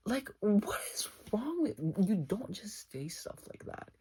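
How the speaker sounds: high whispered aside, then half-voiced; scandalized whisper. Real, not acted. Her scandalized aside to the viewers about him, high and whispered. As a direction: whisper the disbelief, then let some voice back in.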